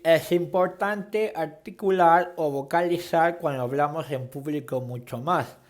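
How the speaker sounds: "Es importante articular" said slowly around a pencil held in the mouth. Shown as a demonstration. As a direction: speak through a pencil clenched in the teeth, over-articulating every word. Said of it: "con un bolígrafo o lápiz en la boca".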